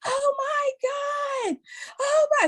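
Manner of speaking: put-on excited kids. Kids' excited reaction.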